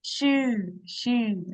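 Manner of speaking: "Shu" pushed through closed teeth; through gritted teeth. Shown as a demonstration. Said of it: "you kinda say it through your teeth".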